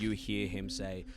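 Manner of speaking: ordinary talk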